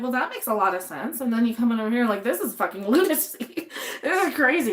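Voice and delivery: ordinary talk, nothing marked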